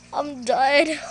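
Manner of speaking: plain reading voice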